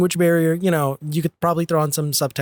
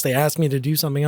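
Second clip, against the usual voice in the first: usual speaking voice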